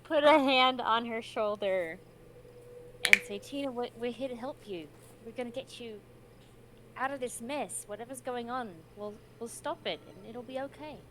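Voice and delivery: reassuring. Acted. Player comforts Tina, raised voice.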